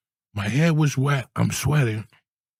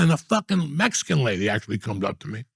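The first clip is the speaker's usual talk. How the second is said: ordinary talk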